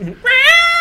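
a high shrieking burst of laughter; loud laughing shout. Real, not acted.

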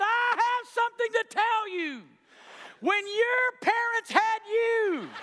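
high, loud, laughing proclamation; a boy bursting with joy, shouting and laughing; gleeful schoolboy yelling his news to the class; triumphant kid shout. Retold or imitated.

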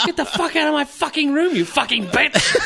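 high-pitched mock angry swearing. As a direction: act a squealed, hammed-up sweary outburst. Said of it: "and swear, you know".

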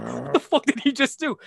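imitating a stunned, high disbelieving wrestler; stunned disbelief. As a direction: act out being blindsided. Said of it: "he's like".

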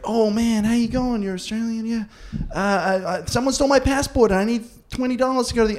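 high put-on American voice; lightly mocking American traveller; American scammer voice. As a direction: play the smooth American asking for money.

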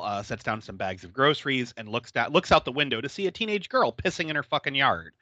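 usual speaking voice

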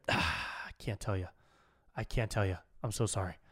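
low, soft, sighing refusal; sigh, then apologise quietly, imitating the caller; hushed apology. Caller apologetically refuses to name company.

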